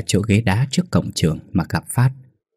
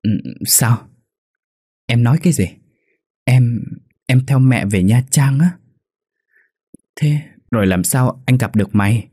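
trembling voice; 4 semitones higher than usual. A narrator's reading. Boy hearing his friend must leave, trembling disbelief. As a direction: voice shaking with shock. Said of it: "run giọng hỏi".

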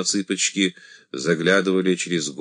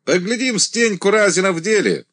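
commanding; 10 semitones higher, 4 dB louder than usual. A narrator's reading. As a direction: announce it to the ranks. Commander addressing regiment.

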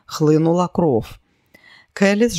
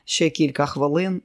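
usual speaking voice

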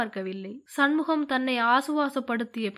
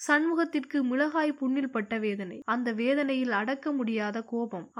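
ordinary delivery, nothing marked

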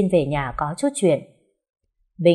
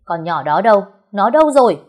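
louder, climbing into a yell; furious demand; 3 semitones higher, 7 dB louder than usual. Acted. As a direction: bark it out, searching in a rage. Bình furiously searching for Mận.

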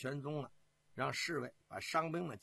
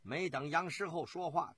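ordinary delivery, nothing marked

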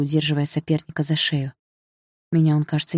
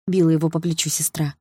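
ordinary delivery

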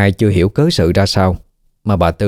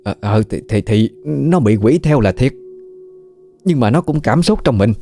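stammering, then higher and faster self-defence; flustered excuses; 4 semitones higher than usual. Acted. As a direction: wriggle out of the blame nervously.

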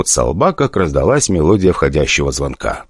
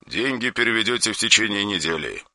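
soft, flat cold order; emotionless; 3 semitones higher, 10 dB quieter than usual. Acted. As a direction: state it without any feeling. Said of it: "став совсем уж безэмоциональным".

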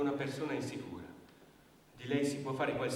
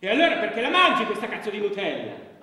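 sudden explosive yell, much higher and louder; furious shout; 8 semitones higher, 14 dB louder than usual. Acted. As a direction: blow up at her without warning. Partner berates her over Nutella.

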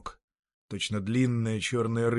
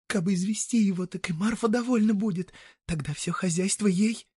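high-pitched village woman plotting; scheming peasant woman; 9 semitones higher than usual. Acted. Marya plotting with her lover in the bushes, high and coaxing. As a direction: coax an accomplice in a high woman's voice.

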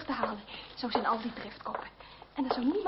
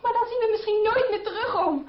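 pleading; 8 semitones higher, 5 dB louder than usual. Acted. Pleading, uncle forbids chase.

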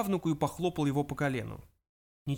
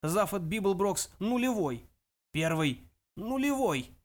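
put-on elderly ghost, high and indignant; imperious old ancestor; 5 semitones higher than usual. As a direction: correct him grandly, stretching the name. Voices the great-grandfather ghost.

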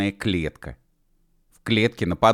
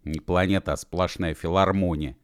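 ordinary delivery, nothing marked

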